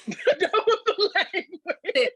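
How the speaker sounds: drawn-out words high through laughter; laughing hard. Real, not acted. Wife joking that divorce was her language, laughing. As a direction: laugh your way slowly through it.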